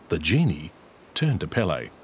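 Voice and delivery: usual speaking voice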